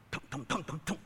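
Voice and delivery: soft low thud-thud of a bad tire; imitated thumping noise. Preacher imitates her car's tire noise, soft low thumping. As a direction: mimic the car's rhythmic thumping quietly. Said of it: "What's that noise?".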